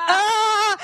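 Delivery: 模仿尖叫; mock scream. Shrieking at hair like spider.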